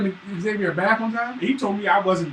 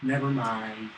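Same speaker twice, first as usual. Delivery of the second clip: put-on lower, softer kid retreat; sulky son backing down; 9 semitones lower, 7 dB quieter, slower than usual. Son backs down after dad's stare, low and soft. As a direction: mumble it and back off. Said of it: "He be like".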